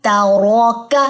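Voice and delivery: तारौका slowly with a full, heavy रौ. Shown as a demonstration. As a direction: lift the voice on रौ. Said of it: "आवाज बुलंद होगी".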